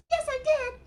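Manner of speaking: a shy little puppet, thrilled; Mimi puppet voice. Acted. Puppet Mimi telling Ellen she loves new friends, high and eager.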